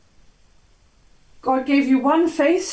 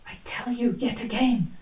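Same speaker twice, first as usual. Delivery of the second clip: whisper; 2 semitones lower, 7 dB quieter than usual. Acted. Sleepwalker's hushed confession.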